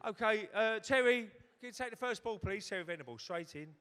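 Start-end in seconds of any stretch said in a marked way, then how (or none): 0.1-2.7 much higher, louder mock presenter; TV host Graham Kelly. Comic imitating the host calling Terry to the pot, high and loud. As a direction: put on the polite TV host asking a guest. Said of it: "Graham Kelly".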